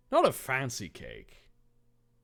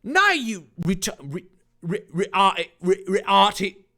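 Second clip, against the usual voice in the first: stumbling Cockney attempt; 7 semitones higher, 7 dB louder than usual. Shown as a demonstration.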